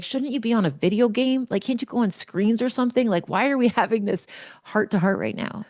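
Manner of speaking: high, teasing exasperation; mock deflection. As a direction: brush him off with a laugh. Mom deflecting son's question.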